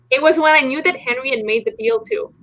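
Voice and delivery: normal talking voice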